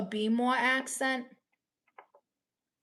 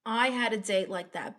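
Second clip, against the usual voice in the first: ordinary delivery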